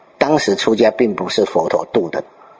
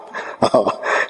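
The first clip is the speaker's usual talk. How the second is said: a quiet, breathy chuckle; soft laugh; 4 dB quieter than usual. Real, not acted. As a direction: chuckle softly to yourself. Preacher laughs at the paradox. (轻声一笑)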